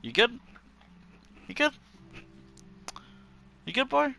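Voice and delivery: high sing-song pet voice; talking to a dog. Real, not acted. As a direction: coo at the dog. Speaking to dog.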